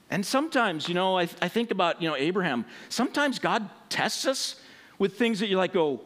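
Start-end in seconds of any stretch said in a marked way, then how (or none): none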